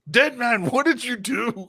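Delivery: high, slow accusing call; mock accusing friend. Imagined friend's words.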